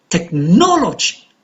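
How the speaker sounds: "Technology" with NO stressed, then falling; tech-NO-lo-gy, peak on NO in "Technology"; stress on second syllable. Shown as a demonstration. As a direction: punch the NO in "Technology".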